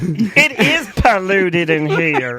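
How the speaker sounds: very slow, hammed-up put-on voice; comic character appalled by the mess; mock horrified discovery. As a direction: drag out each word in mock horror. Playing staff discovering the mess.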